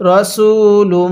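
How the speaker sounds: রসুলুম stretched long and chanted; slow Quranic recitation. Shown as a demonstration. Teacher shows how to read the word, slowly chanted. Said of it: "তাহলে".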